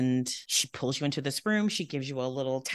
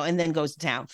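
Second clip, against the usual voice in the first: normal talking voice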